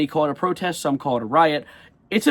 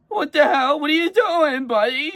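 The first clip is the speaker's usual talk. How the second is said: high-pitched incredulous outburst; hammed-up bafflement; exasperated disbelief; 11 semitones higher, 3 dB louder than usual. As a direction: call them out in a much higher voice. Mock outburst at lying news.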